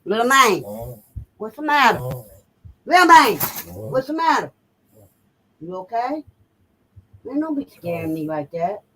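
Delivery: tender pet talk to her dog; coaxing the dog. Real, not acted. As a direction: gently coax a frightened little dog.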